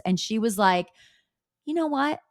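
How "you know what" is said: put-on higher-pitched take on Dolores's accent; imitated Dolores accent. Retelling Dolores's response to gossip, light imitation of her accent. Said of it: "In her accent".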